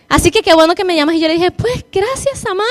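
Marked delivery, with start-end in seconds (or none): 1.5-2.7 voz quebrada. Retold or imitated. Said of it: "me eché a llorar".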